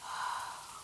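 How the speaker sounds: long, soft exhale; weary sigh. Acted. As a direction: let out a tired sigh. Queen alone after tiresome meeting.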